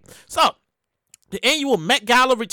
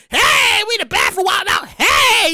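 rowdy hollering; 6 semitones higher, slower than usual. Acted. Celebrities posing for a bathroom selfie, rowdy hollering. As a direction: whoop it up with long heys.

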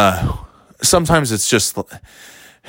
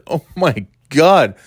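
retold reaction; 6 semitones higher, 3 dB louder, slower than usual. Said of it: "I said".

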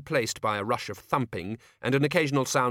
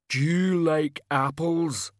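slow, odd hulking voice; rumbling brute; 7 semitones higher, 4 dB louder, slower than usual. Acted. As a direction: menace like a slow giant. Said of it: "Banjo rumbled".

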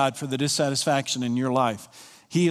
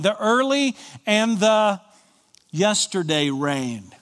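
fervent scripture proclamation; 5 semitones higher than usual. Real, not acted. Preacher reading Joel aloud to the congregation, ringing and fervent. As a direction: proclaim it like a herald.

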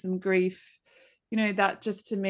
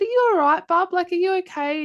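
higher, louder, anxious check-in; concerned mum; 11 semitones higher, 7 dB louder than usual. Retold or imitated. Mother checking on her tearful son, higher and louder with concern. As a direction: ask the tearful boy if he's okay, full of worry. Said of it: "And I said".

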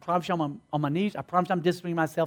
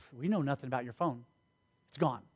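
dismissive denial; 6 semitones lower, 11 dB quieter than usual. Retold or imitated. Security denies having the phone.

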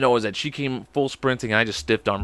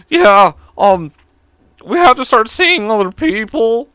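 much higher and louder, slow and stiff; uncool original take; 10 semitones higher, 10 dB louder, slower than usual. Retold or imitated. The real breakup call to his girlfriend, high and awkward. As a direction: blurt it out high and awkward like a nervous teen. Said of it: "it wasn't cool ... It was more like".

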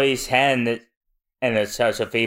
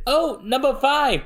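high-pitched cry; 12 semitones higher than usual. Acted. Character cries out.

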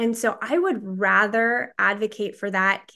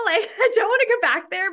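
rueful laugh, voice jumping higher; 12 semitones higher, faster than usual. Real, not acted. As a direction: laugh off the bad memory. Recalling horrible times, laughing.

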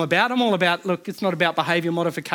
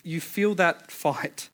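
normal talking voice

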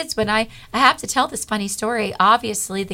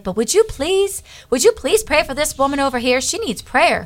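much higher, faster, eager pleading; 6 semitones higher than usual. Retold or imitated. Woman urging the speaker to pray for her friend, high and pleading. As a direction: beg on behalf of a friend, voice pitched way up.

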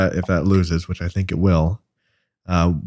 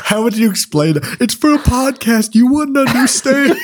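laughing; 14 semitones higher than usual. Pretend. Acting out excuse to parents.

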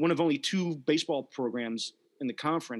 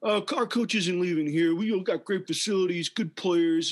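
mock-defensive quote, pitched up; defensive mimicry; 5 semitones higher, 3 dB louder, slower than usual. Host mocking Florida for insisting their coach stays, mock-defensive. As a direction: imitate a defensive fan, a little louder and higher. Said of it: "Florida's so defensive".

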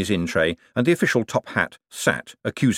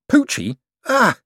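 startled yelp; 13 semitones higher, 4 dB louder, slower than usual. A narrator's reading. Moist wakes to shock.

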